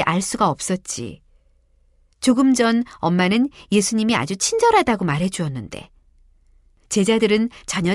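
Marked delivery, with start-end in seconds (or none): none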